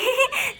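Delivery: high, soft little giggle; cheeky giggle. Acted. Child hides from mother, soft high giggle. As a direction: giggle to yourself while hiding.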